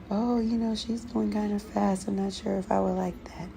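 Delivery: imitating a client with mild doubts; imagined doubtful viewer. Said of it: "things like".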